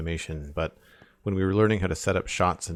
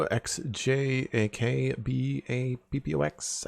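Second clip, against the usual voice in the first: slow, each letter said on its own; spelled out letter by letter; 5 semitones higher, slower than usual. Shown as a demonstration. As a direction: spell the username out carefully. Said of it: "so I'm just gonna spell it".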